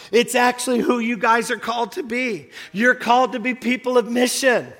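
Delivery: rising to a loud, fervent preach; building sermon. Acted. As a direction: pound it home to the congregation.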